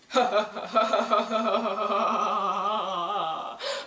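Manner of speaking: long, rolling villain cackle; hammed-up, drawn-out evil laughter. Villain revealing himself to his foes, evil laugh. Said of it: "like, evil laugh".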